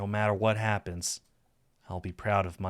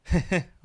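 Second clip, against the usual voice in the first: short, higher-pitched giggle; amused chuckle; 10 semitones higher, 5 dB louder than usual. A narrator's reading. Lover amused by Saphir's pleading, chuckling. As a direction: chuckle before giving in.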